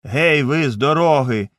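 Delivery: loud call to clear the road; coachman's shout. A narrator's reading. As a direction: yell at the crowd to move. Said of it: "гукав якийсь кучер".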